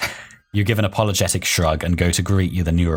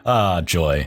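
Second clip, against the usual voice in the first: usual speaking voice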